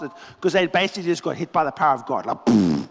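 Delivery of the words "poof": low poof. Retold or imitated. Power of God hits soldiers.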